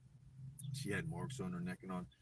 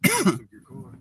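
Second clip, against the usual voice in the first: loud; 12 semitones higher, 21 dB louder, slower than usual. Retold or imitated. Valet's news retold.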